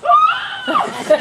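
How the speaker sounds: very high, loud imitated monkey screech; mock monkey shriek from the corner.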